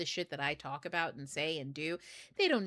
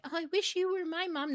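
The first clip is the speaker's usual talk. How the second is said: high-pitched mock wishing; gushy mimicry of other people; imitating admirers; 11 semitones higher than usual. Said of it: "when people are like".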